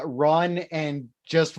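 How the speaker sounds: ordinary talk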